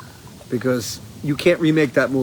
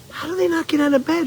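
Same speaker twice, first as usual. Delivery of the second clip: much higher baffled question; incredulous; 13 semitones higher than usual. Retold or imitated. Recalling what he said watching the bedridden grandparents, mock baffled. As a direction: ask it in disbelief. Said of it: "I would say".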